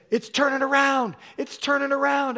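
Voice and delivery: high, loud proclaiming; ringing prophetic cry; prophetic shout. Real, not acted. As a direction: declare it with full force. Preacher prophesying loudly over woman.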